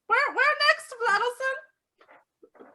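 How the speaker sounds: put-on high, drawn-out old lady question. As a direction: ask slowly like a lost old woman.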